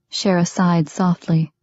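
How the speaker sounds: usual speaking voice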